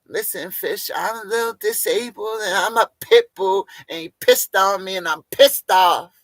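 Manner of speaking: high, loud put-on whiny voice; hammed-up offended whine; mock pit bull Nicole. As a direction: play a confused angry dog-woman. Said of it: "same Nicole pit bull voice".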